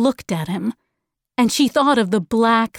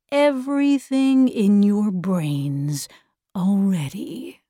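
patronising villain. Acted. Figure of the controlling voice.

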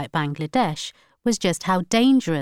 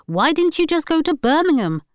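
higher, louder mocking voice; mocking relatives; 9 semitones higher, 5 dB louder than usual. Wife mimicking relatives' taunts, mocking. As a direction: mimic the family's jeer. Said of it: "they will ask".

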